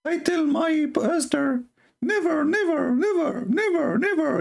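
mocking high pastor voice. Commentator mocking the pastor's never speech, shrill mock voice. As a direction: squeak it like a silly puppy. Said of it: "You sound like a dog".

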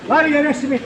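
sing-song hawker's call; melodic street vendor shout. Real, not acted. Simit seller calling to customers.

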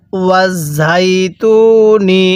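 ওয়াজ ঝাই তু নি with held ঝাই and long তু; drawn-out recitation. Shown as a demonstration. Teacher shows learners how to read, drawn-out ওয়াজ ঝাই তু নি. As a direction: stretch ঝাই and তু evenly. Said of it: "এরকমভাবে শিখব".

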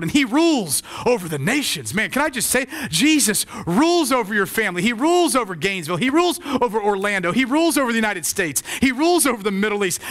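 high-pitched, punchy preacher's cadence; impassioned preaching. Real, not acted. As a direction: proclaim it to the congregation with rising fervor. Preaches at full force.

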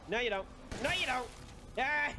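ordinary talk, nothing marked

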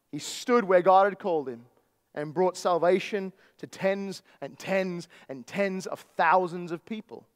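usual speaking voice

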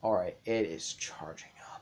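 tense whisper. Real, not acted. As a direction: whisper it under your breath. Tense moment in fight.

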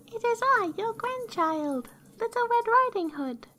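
very high, put-on little-girl voice; wolf faking a child, squeaky high; counterfeit girl's voice. A narrator's reading. Wolf at granny's door, counterfeiting the girl's high voice. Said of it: "counterfeiting her voice".

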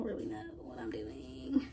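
whispered aside to herself; breathy unvoiced whisper. Real, not acted. Whispered aside while styling.

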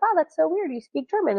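high-pitched mock amazement on "Wow"; mock bafflement. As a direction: act out someone naively puzzled. Acting out the same baffled person.

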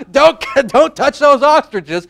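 delighted echo of the catchphrase; laughing repeat. Real, not acted. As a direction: repeat it while cracking up. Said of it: "Say your catchphrase".

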